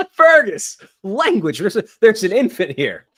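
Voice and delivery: high, loud, laughing mock outrage; mock scolding. Co-host telling off partner for swearing near infant, mock-scandalised.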